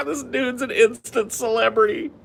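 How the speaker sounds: laughing hard. Real, not acted.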